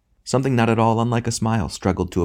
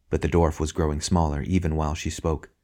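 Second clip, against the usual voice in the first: plain reading voice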